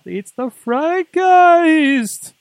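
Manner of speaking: high, slow, booming ghostly voice; spooky voice. Acted. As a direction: draw it out like a ghost. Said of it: "It's Halloween. I'm allowed to make funny noises".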